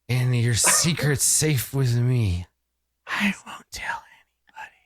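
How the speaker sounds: hushed character voice; quiet confiding. Acted. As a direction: let the vow sink into a secretive whisper. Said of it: "he says quietly".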